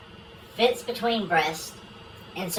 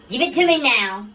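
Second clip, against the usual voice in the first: louder, higher demanding yell on now; screaming; 5 semitones higher, 6 dB louder than usual. Acted. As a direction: shout the now out, pushing hard. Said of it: "A wife screams".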